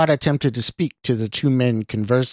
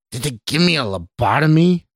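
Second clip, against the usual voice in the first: higher, louder incredulous demand; bellowed; 5 semitones higher, 5 dB louder than usual. A narrator's reading. Stranger mocks Scott inside his mind, bellowing. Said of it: "the stranger bellowed".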